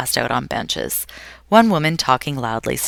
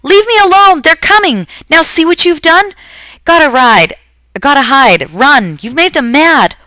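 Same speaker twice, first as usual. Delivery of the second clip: shrill panicked screams at the top of her lungs; wild high wailing scream; 13 semitones higher, 12 dB louder than usual. A narrator's reading. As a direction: scream it like a frightened, half-mad old woman. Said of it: "she started screaming at the top of her lungs".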